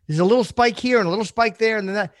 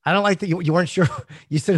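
ordinary talk

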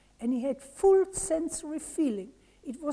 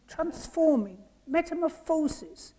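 usual speaking voice

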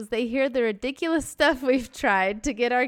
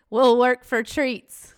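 louder, slow mock chant of a greedy kid; mock kids' sign slogan; 2 semitones higher, 8 dB louder, slower than usual. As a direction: read it out like a sign the kids hold, hammed up. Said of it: "holding a sign".

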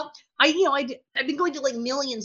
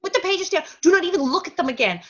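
urgent order; 7 semitones higher than usual. Retold or imitated. Father's urgent order, repeated.

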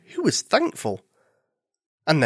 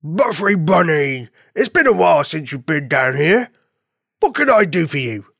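friendly farm dog; 4 dB louder than usual. Acted. Farm dog welcoming his bunny visitor, hearty.

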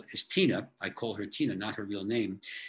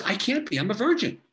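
retold shocked protest, pitched much higher and louder; shocked denial; 9 semitones higher, 5 dB louder than usual. Teen patient protesting to her gynecologist, in retold high shocked disbelief. Virgin told she is pregnant.

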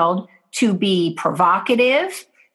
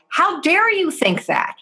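high, sharp indignant retort; a mild mock of an offended parent; 9 semitones higher than usual. Parent snapping back at the accusing child, mildly indignant. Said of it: "responds by saying something like".

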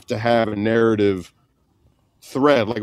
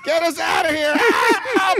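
mock panicked cry for help; slower than usual. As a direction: scream for help like you're trapped. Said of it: "We're like".

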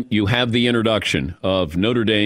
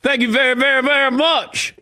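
[name] impression; 13 semitones higher than usual. Host imitating [name] thanking a crowd, lightly mimicked. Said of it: "[name] does this".

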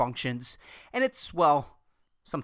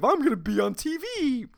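dumb mentality; 6 semitones higher than usual. Retold or imitated. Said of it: "That fucking dumb mentality of".

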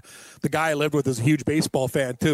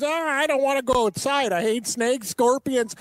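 mock old guy; 9 semitones higher, slower than usual. Mocking old guys complaining.

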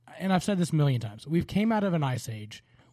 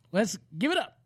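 hype-man intro, big build; booming pumped announcer; 9 semitones higher, 3 dB louder, slower than usual. Acted. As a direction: build up and shout the name. Host hypes co-host intro.

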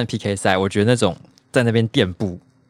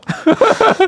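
squealing laugh up high; high burst of laughter; 4 dB louder than usual. Real, not acted. Laughs at own post.

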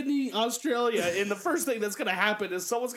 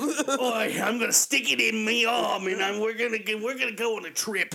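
mock Australian junkie. Mock Aussie junkie bragging.

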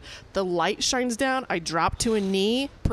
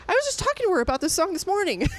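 imitating amazed girlfriend; 10 semitones higher, faster than usual. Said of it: "She's like".

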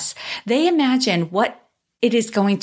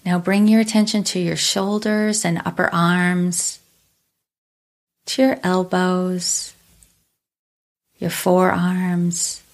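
calm meditative tone; 3 semitones lower than usual. Acted. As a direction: ease them into stillness, unhurried. Leads a guided body scan.

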